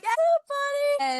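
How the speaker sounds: very high, drawn-out cry for help; urgent call. Retold or imitated. Crowd at Red Rocks calling out for help, high and slow. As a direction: shout for help over the song.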